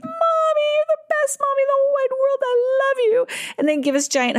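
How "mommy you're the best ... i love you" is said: put-on much higher, fast, gushing child voice; squeaky little kid gushing love to mom. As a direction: coo it like an adoring small child. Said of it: "they'd be like".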